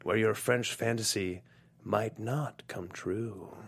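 slow, soft menacing line; menacing villain. Acted. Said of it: "our action movie tagline".

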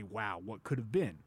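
ordinary talk, nothing marked

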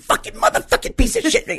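very high, shrieking mock rage; furious cursing dad. Mad Max father losing it at the phone, mock furious rant. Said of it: "He'd be".

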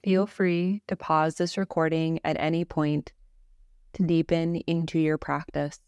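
measured Yoga Nidra narration, little pitch movement; guided meditation. Acted.

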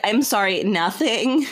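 ordinary talk

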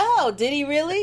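high, loud, gleeful retold reply; mock gloating. Valentine hears Curtis grovel on the phone, mock gloating. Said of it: "He was so gloating".

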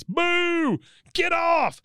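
boo him off the stage; heckler yell. Retold or imitated. A heckler in the audience, in a high jeering yell. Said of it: "somebody in the audience".

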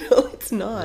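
high, loud objection through laughter; laughing protest. Real, not acted. Spouse refusing death talk on podcast, laughing protest. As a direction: protest while cracking up.

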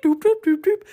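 high, loud mock R2-D2 beeping; droid beeps. Describing R2-D2 to listeners, with high, loud mock droid beeps. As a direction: beep like a little droid. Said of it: "android that just goes doop".

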